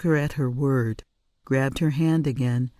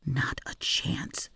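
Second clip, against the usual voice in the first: slow, much softer whisper; 8 dB quieter, slower than usual. A narrator's reading. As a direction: refuse under her breath. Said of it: "whispered the mouse".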